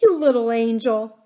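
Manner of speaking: loud, fond outburst; crying out fondly. A narrator's reading. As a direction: hug the child with your voice. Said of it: "cried the nurse".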